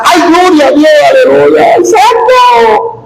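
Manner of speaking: high, crying shout of praise; shouted tearful aleluya; ecstatic praise shout. Retold or imitated. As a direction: cry out to God in rapture.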